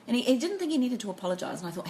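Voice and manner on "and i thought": ordinary talk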